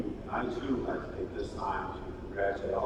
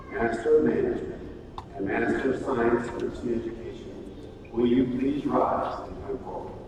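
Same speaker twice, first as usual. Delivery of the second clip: measured, slightly raised proclamation voice; stately graduation proclamation; formal ceremony address; 3 semitones higher, 4 dB louder than usual. Acted. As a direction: read it out like presenting degree candidates at a podium. Formal conferral of degrees.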